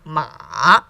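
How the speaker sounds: MA low, dipping, then going up; third tone. Shown as a demonstration. Teacher going through the chart, dipping MA. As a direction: scoop MA down and up, strongly. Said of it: "starts low".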